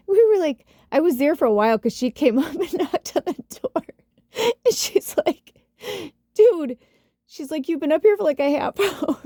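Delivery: high voice breaking into real laughter; laughing while telling. Real, not acted. Telling how friend came knocking on the door, laughing. Laughing through a drunken anecdote.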